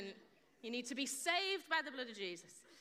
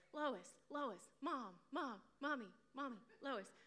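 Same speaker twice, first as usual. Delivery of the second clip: mild impression of a nagging cartoon kid; lightly voiced child pestering for attention; 5 dB quieter than usual. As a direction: repeat the name in evenly spaced little calls. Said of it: "like Family Guy".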